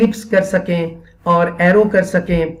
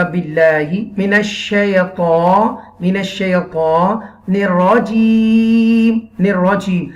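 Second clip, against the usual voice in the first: put-on slow child repeating; much slower than usual. Shown as a demonstration. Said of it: "بچہ پڑھے گا".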